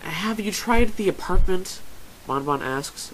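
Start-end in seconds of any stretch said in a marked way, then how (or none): 0.0-1.8 high, slow, knowing question; loud, teasing self-satisfaction; smug. A narrator's reading. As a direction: draw it out smugly. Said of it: "a smug smile on her face".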